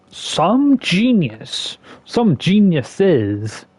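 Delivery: slow, high sarcastic drawl. Real, not acted. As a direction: stretch it out mockingly. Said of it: "and I'm being sarcastic".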